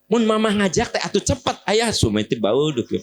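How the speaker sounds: high-pitched scolding, easing down; acted wifely grumbling; nagging wife. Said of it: "ibu ngomel ka salaki".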